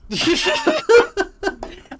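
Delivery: loud, high, helpless laughing; real explosive high-pitched laugh; burst of laughter. Real, not acted. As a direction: crack up loudly. Laughing at the carpet image.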